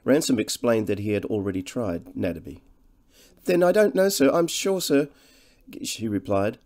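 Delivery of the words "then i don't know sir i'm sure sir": high, louder, apologetic countrywoman; humble woman's voice, pitched well up; humbly fretful woman. A narrator's reading. Cottage woman startled by a stranger at her door, humbly fretful. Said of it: "She spoke in a humbly fretful voice".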